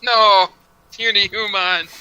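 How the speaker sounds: loud anguished cry; dismayed shout. Real, not acted. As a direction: yell out in horror. Companion being smothered by shadows.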